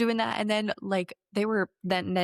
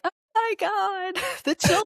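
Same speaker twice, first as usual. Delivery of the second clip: high, slow, hammed-up panic; mock panicked churchgoer; 12 semitones higher, 3 dB louder, slower than usual. Churchgoer panicking about the children, high, slow, hammed-up. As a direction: wail it high and slow, mocking the hysteria. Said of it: "and you were like".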